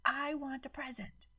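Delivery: high tantrum cry, stress on I; shrieking child. A narrator's reading. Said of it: "Maddie started shrieking".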